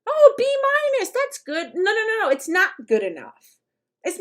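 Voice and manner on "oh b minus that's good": high, falsely cheerful pat on the back; sing-song fake approval, much higher; mock sweet praise. Mocking a parent who settles for a B minus, high and sugary. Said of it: "Knowing that I know you can get an A".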